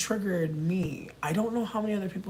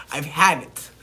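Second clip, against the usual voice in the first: louder; 2 semitones lower, 7 dB louder than usual. Real, not acted. Repeats louder.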